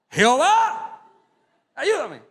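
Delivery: high, loud mock panicked cry; hammed-up panicked prayer; desperate plea. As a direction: cry out to God in mock alarm. Desperate prayer while riding.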